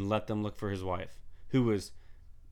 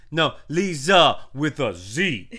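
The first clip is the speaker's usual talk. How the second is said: high, loud, slow mock drawl spelling her name; mock correction; 5 semitones higher, 11 dB louder, much slower than usual. Imitating the woman insisting on her name, loud and slow. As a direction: stretch it out like a smug correction.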